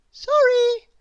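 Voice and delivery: a hint of a high, polite baker; chirpy feigned deafness; lightly voiced shopkeeper. A narrator's reading. Missus Crumb pretending not to hear, high. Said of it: "said Missus Crumb".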